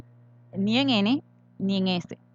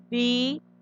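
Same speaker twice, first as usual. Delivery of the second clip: bicicleta muy lento: bi... ci... cle... ta; separado en sílabas; 3 semitones higher than usual. Shown as a demonstration. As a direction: pronunciar bicicleta sílaba por sílaba, con pausas. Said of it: "Yo miro, pronuncio". (bicicleta dividida, cada sílaba alargada)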